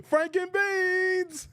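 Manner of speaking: high, slow, drawn-out squeal; squealed movie quote. Retold or imitated. Streamer gleefully quoting the movie line, drawn-out squeal. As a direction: stretch it out high and delighted.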